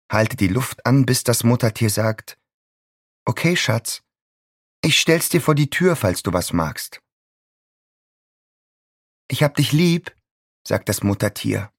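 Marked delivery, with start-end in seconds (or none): 3.3-7.0 sugary mother. A narrator's reading. Mother voiced at door. 9.3-10.2 high, syrupy mother voice; mother cooing love through the door; sugary mother. A narrator's reading.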